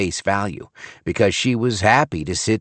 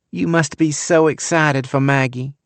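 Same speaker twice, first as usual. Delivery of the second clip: put-on syrupy Southern accent; 7 semitones higher than usual. A narrator's reading. Young wife greeting Frank in syrupy Southern accent. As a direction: gush in a Gone with the Wind Southern accent. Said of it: "syrupy accent".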